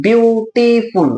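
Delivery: "বিউটিফুল" stretched slowly into three parts; syllable by syllable. Shown as a demonstration. Teacher giving an example word, "বিউটিফুল" slowly split by syllable. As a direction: draw out "বিউটিফুল" as বিউ-টি-ফুল. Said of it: "আমি বিউটিফুল একবারে বলতে পারছি না".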